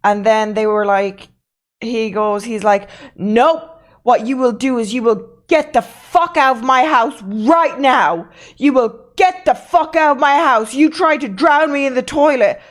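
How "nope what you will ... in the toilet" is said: raised, higher-pitched imitation of a furious order; angry ranting. Drunk host ordering friend out of his house, angry. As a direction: act out throwing someone out, pushing key words.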